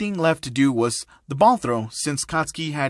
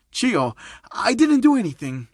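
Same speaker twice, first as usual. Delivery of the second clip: higher, defensive protest; flinching; 7 semitones higher, 3 dB louder than usual. Acted. Old trainer flinching before the scolding nurse, defensive. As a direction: protest innocence as if caught. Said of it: "Torino flinching, saying".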